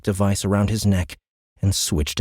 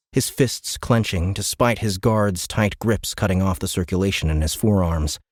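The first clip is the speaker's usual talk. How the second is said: ordinary delivery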